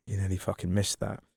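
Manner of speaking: inner voice, quiet. Retold or imitated. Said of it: "his brain went".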